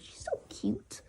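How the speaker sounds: soft whisper, breath only. Real, not acted. Watching his sleeping cat, whispering softly. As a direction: whisper so she stays asleep.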